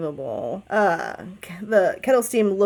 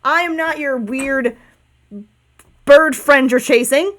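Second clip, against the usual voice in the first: dude-voiced bird; 2 semitones higher than usual. Bird to the cats pestering it at the carnival, aggrieved dude voice. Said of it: "I have to do a dude voice".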